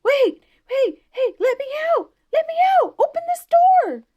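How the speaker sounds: very high, loud frantic yells; ghost whooping. A narrator's reading. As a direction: whoop and holler from behind the locked door. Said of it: "that old ghost just a whooping".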